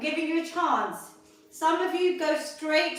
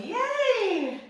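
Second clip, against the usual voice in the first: excited cheer; 6 semitones higher than usual. Real, not acted. Cheering as the set ends.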